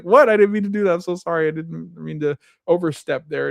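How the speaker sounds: high-pitched, hammed-up backpedalling; flustered mock apology. Employee apologizes to his intimidating boss, flustered mock apology. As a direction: apologize in a fluster, imitating him.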